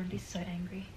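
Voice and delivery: soft whispered threat. Real, not acted. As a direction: whisper it under the breath. Dreading rotten egg bean.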